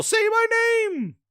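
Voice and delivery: very high shouted beg; mock shouted plea. Host replays Bootleg Joel's plea for chat, high mock shout. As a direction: yell it like the desperate ghost. Repeats parody ghost plea.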